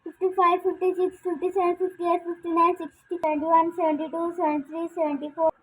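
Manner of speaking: loud counting for hide and seek; calling out count. Real, not acted. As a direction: shout out the numbers. (గట్టిగా లెక్కపెట్టడం)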